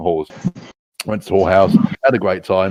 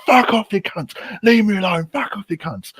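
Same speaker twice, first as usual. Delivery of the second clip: indignant protest; 11 semitones higher than usual. Retold or imitated. Interrupted man tells police to go away, high indignant protest. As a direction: whine angrily to be left alone, pitched high. Acted yelling at intruding police.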